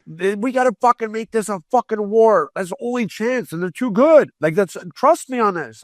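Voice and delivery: high, intense battle call; rally the coach for war; fired-up demand. Retold or imitated. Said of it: "I'm like".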